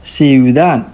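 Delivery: ciudad said fast as two syllables, siu-DAD; quick Spanish ciudad. Shown as a demonstration. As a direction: glide the i and u together in ciudad. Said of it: "it is a quick pronunciation".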